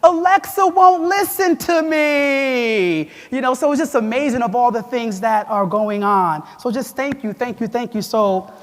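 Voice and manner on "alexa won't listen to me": put-on high, slow, louder child's wail; crying daughter. Father imitates his daughter complaining Alexa won't listen, high childish whine. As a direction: mimic a little girl upset at Alexa. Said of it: "And she goes".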